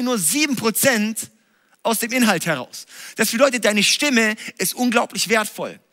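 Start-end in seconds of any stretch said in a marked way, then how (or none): none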